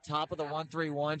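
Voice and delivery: ordinary talk, nothing marked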